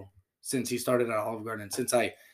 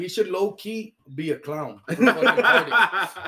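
laughing slowly; 4 semitones higher, 7 dB louder, slower than usual. Real, not acted. Joking about friend as clown.

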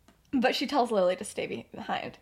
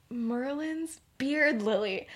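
exasperated; 3 semitones higher, 3 dB quieter, much slower than usual. Retold or imitated. Exasperated teacher reacting, acted.

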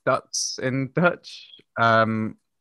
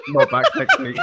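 high, loud, laughing mock French accent; a hammed-up terrible French accent through laughter; worst French accent; 5 dB louder than usual. The French listener swearing off the show in a high mock French accent. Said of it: "In the worst French accent possible".

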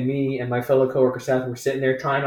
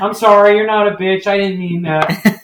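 put-on drunk apology; 6 semitones higher, 8 dB louder than usual. Drunk woman apologizing to her friend, loud sloppy sorry. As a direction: gush sorry like a tipsy customer. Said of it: "and goes".

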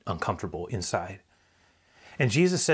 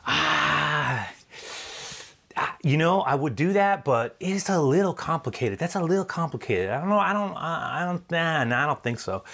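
higher, hesitant voice of the woman dodging; evasive woman; 6 semitones higher than usual. Acted. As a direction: hem and haw, squirming away from it. Woman dodging the question about husband.

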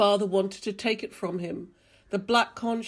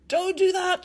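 high, loud shout of protest; 11 semitones higher, 7 dB louder than usual. A narrator's reading. Thomas Clayson stopping Nicer cutting the line, shouted. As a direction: yell to stop him sharply. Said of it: "shouted Thomas Clayson".

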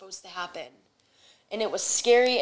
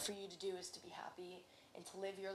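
whisper; 21 dB quieter, faster than usual. Real, not acted. Intimate advice to grieving listeners.

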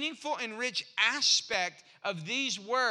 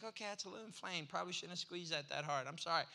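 low, soft doctor's aside; hushed, lowered admission; sheepish apology; 5 semitones lower, 10 dB quieter, faster than usual. Retold or imitated. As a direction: apologize quietly, playing it down.

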